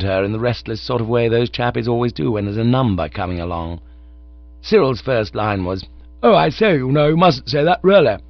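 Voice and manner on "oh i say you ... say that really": high, loud amateur-actor delivery; full of vim. A narrator's reading. Cyril delivering his first line on stage, full of vim. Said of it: "passed it over the larynx with a goodish deal of vim".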